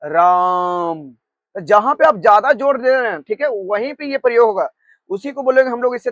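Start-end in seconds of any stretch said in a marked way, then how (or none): 0.0-1.1 "राम" stretched very long as a call; drawn-out calling "राम". Shown as a demonstration. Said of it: "जैसे बोलिएगा हे".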